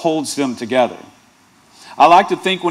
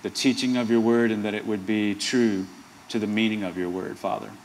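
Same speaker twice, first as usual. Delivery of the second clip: soft, low opening prayer; 5 semitones lower, 6 dB quieter than usual. Acted. Pastor opening the sermon in prayer, soft and low. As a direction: pray quietly over the reading.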